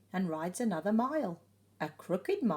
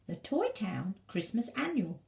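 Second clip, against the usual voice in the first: ordinary delivery, nothing marked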